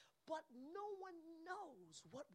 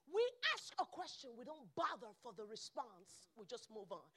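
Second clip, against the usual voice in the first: ordinary delivery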